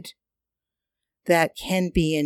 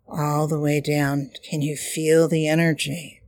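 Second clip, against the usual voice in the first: airy breathy mystical healer. Acted. Leads chakra breathing meditation.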